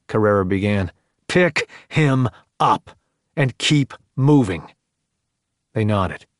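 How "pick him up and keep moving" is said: higher, slow and deliberate command; insistent sergeant, spacing out each phrase; slow, emphatic order. A narrator's reading. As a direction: repeat the order slowly, word by word. Sergeant repeats order, cutting off objection.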